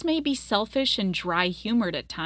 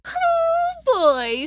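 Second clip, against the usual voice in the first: excited exclamation; 6 dB louder than usual. Real, not acted. Excited aside before long reading.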